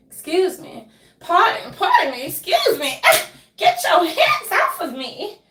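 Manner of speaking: loud, high mock outrage; indignant pushing-through. Acting out being grabbed, loud mock indignation.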